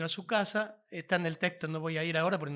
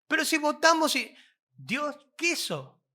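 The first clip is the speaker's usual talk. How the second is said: high, louder protest, "Dios quiso" slowed; mock objector; 8 semitones higher, 4 dB louder than usual. Preacher voices a churchgoer protesting about voting, high and mocking.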